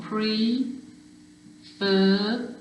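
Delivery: "pre, fur" split slowly, with weight on "fur"; stress on second syllable. Shown as a demonstration. Teacher showing spellers the stressed syllable, "pre, fur" split with stress on "fur". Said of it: "there's your stressed syllable".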